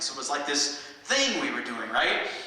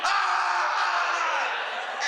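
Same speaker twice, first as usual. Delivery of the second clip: high angry yell, louder; enraged roar; 3 dB louder than usual. Retold or imitated. Drunk guy lunging at the stage, high angry yell. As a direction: let out a furious drunk's roar. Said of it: "he went".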